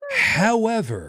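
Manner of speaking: drawn-out theatrical however, pitched high; dramatic however. Pretend. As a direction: stretch it like a grand announcement. Dramatic pause before punchline.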